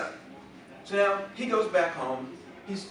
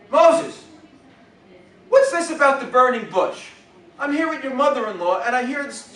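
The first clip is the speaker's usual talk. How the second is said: high, loud nagging Yiddish mother; Yiddish accent; 8 semitones higher, 10 dB louder than usual. Acted.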